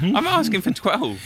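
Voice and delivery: high, loud caricature of a greedy musician; mock jealous artist. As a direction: squeal it like an outraged rival star. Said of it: "Then you'd read those, like".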